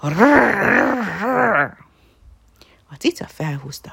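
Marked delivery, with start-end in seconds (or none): 0.0-1.8 higher, louder rolling growl; puppy imitating a growl. A narrator's reading. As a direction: growl trying to sound big. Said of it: "ő is morogni kezdett".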